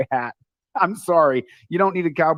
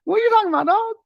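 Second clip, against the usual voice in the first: shrill-high, loud quoted outburst; high-pitched friend impression; 7 dB louder, faster than usual. Imitating his best friend from Brooklyn, high-pitched and loud. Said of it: "why you're talking so high pitch".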